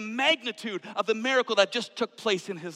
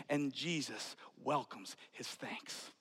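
slow, much softer whisper; 5 semitones lower, 11 dB quieter, slower than usual. Acted. Preacher to congregation, slow hushed whisper. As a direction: let it land gently. Soft close of point.

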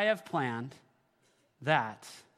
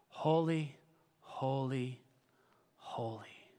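fading holy; slower than usual. Acted. Pastor echoes the angels, fading to a whisper. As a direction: let it die away to a breath.

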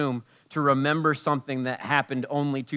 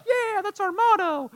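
high, cheesy chirp; mock eager congregation. Mimicking the church, a high cheesy chirp. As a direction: sing it like a proud slogan. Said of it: "We're like".

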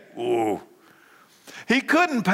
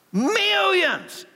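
much higher, ringing "Millions"; excited exclamation. Shown as a demonstration. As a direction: shout "Millions" in delighted amazement. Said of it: "you better start cheering".

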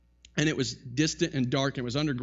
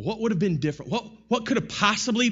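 ordinary talk, nothing marked

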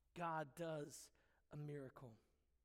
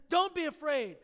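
much louder, firmly declared; bold reassurance; 4 semitones higher, 9 dB louder than usual. Retold or imitated. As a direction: call it out to the fearful people. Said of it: "But then he says".

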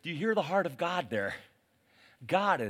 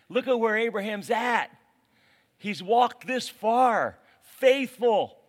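ordinary talk, nothing marked

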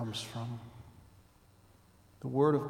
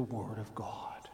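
whisper; 5 semitones lower, 9 dB quieter than usual. Acted. Whispered aside in sermon.

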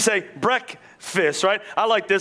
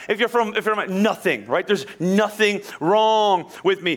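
ordinary talk

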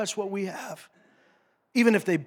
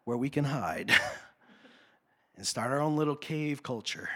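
mock timid whimper; 5 semitones lower, 7 dB quieter than usual. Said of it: "They're not running away going".

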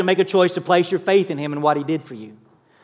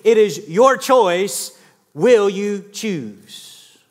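slow, weighty appeal; much slower than usual. Real, not acted. Altar call, pressing a decision.

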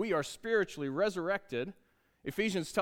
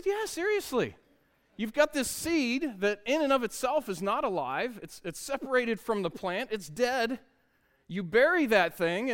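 animated dad explaining; 5 semitones higher than usual. Retold or imitated. Dad explains seeds to son.

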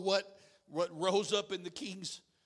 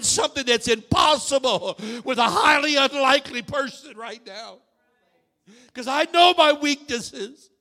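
loud, rising, shouting sermon; preaching at full force; 5 semitones higher, 16 dB louder than usual. Real, not acted.

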